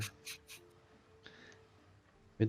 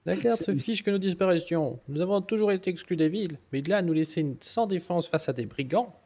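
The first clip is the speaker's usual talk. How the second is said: high-pitched NPC voice; 8 semitones higher than usual. Acted. Continues NPC priestess voice.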